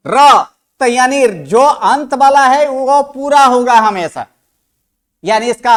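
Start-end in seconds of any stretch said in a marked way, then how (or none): 0.1-0.4 र as a loud, complete "ro"; full र sound. Shown as a demonstration. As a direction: voice र loudly with its vowel. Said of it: "अंत में आप क्या बोल रहे हो?". (र को ज़ोर से पूरा बोलना)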